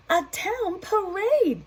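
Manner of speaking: very high, slow cheerful cry; crowd declaring. Acted. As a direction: announce it with delight. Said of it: "they all declared".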